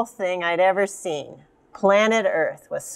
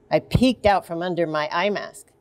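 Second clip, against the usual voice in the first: plain reading voice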